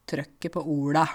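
broad rural Norwegian TRØKKET PÅ ORDA; rougher, broader home dialect. Shown as a demonstration. Teacher contrasting with Oslo speech, broad rural dialect. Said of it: "Du hører at det er mye breiere".

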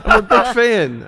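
high, loud gushing fan; mock starstruck fan. Fighter greeting the coach, starstruck and excited. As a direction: gush the nickname. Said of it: "stopped on a dime".

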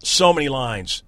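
ordinary talk

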